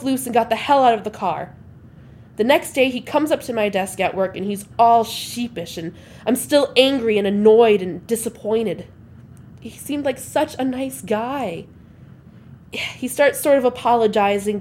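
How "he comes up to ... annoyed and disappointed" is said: usual speaking voice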